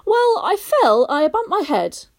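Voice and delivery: put-on boy voice. A narrator's reading. Barney tells of his fall.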